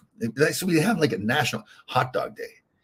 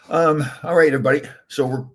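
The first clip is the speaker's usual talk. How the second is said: hiccups; 4 semitones higher, 5 dB louder than usual. Real, not acted. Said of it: "I'll get rid of the hiccups".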